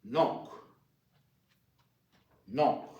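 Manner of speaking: "Nonc" said short with a clipped k ending; repeat-after-me model. Shown as a demonstration. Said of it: "And uncle is nonc".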